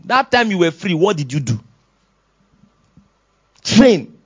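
ordinary talk

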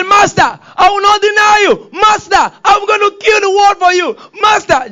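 shouting high and loud, almost crying; Peter's desperate oath. Acted. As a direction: swear loyalty at the top of your voice. Peter's wild oath to Jesus.

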